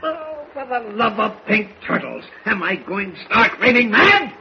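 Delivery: exasperated outburst. Acted. Editor exasperated by reporter's refusal.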